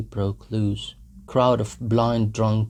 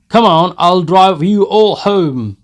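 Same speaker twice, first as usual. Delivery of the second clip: put-on loud, higher drunken bellow; Mikolka shouting; 9 semitones higher, 14 dB louder than usual. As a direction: bellow it over the laughter. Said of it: "Mikolka shouted again".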